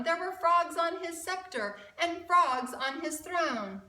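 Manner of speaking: lively rhyming storybook read; reading to children. A narrator's reading. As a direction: read it like a picture book for kids. Rhyming picture book read aloud.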